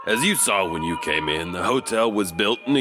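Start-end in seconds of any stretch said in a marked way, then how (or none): none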